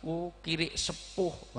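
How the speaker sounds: slow, drawn-out polite insult; mock refined cursing. Shown as a demonstration. Preacher shows anger can't use refined language, mock-polite cursing. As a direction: curse as if speaking courtly Javanese, slowly. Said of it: "kok berbahasa halus".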